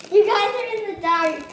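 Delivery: loud, high giddy echo; excited echo. Real, not acted. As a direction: copy mom with glee. Child echoes mom, laughing.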